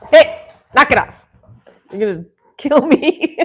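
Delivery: half-laughing telling the dog off; laughing scold. Real, not acted. Dogs jumping on her.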